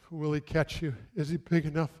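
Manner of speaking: usual speaking voice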